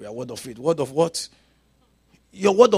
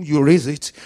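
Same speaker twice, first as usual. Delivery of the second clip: ordinary talk, nothing marked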